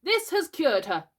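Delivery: high, slow, loud verdict; boy playing important doctor; pretend doctor. A narrator's reading. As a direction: pronounce the cure grandly.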